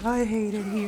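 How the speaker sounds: soft, put-on little voice of misery; mock a quiet sufferer stuck among teasers; imitating sulking friend. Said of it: "Jeff is just sitting there".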